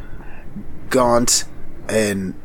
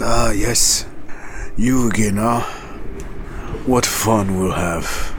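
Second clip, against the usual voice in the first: Ogum. Acted. Ogum mocks Gary on meeting again, sarcastic voice. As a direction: sneer at an old acquaintance.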